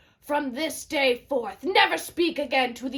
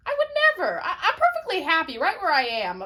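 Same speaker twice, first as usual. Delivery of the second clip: higher, fast, laughing protest; nervous laughing denial; 6 semitones higher, much faster than usual. Acted. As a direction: laugh off the accusation in a hurry. Creon flustered, denies treason.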